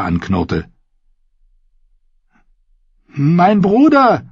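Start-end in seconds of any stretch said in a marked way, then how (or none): 3.1-4.3 very high, loud, slow shout. Acted. Clegane yells to Arya over the slaughter, loud drawn-out shout. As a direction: yell it over the battle noise. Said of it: "schrie er ihr zu".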